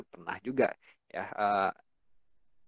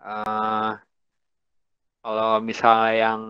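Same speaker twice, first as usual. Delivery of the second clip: usual speaking voice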